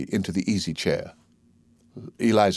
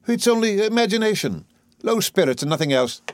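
high, airy dismissal; patronizing comfort, pitch lifted; dismissive reassurance; 10 semitones higher than usual. Acted. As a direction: wave the trouble away. Higgins dismisses Eliza's distress.

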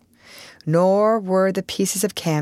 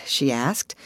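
ordinary delivery

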